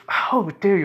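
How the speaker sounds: high, loud indignant retort; mock outrage. Insulted rival objects, in mock outrage. Offended rival in acted quarrel.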